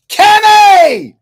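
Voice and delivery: long, loud, high yell of a name; yelling a friend's name across the room; shout. Real, not acted. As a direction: call out the name as loud as you can. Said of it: "that's just the way I like to yell out his name".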